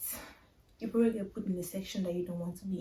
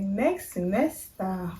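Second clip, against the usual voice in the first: drawn-out disbelieving question; stretched-out, dismayed repeat; incredulous echo; slower than usual. Retold or imitated. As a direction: throw it back slowly, appalled. Echoes the advice in disbelief.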